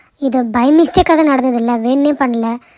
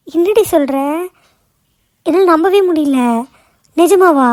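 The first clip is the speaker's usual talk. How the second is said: amazed disbelief; 3 semitones higher than usual. Acted. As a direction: gasp out your happy disbelief. Friend cannot believe the good news. (நம்ப முடியாத வியப்பில்)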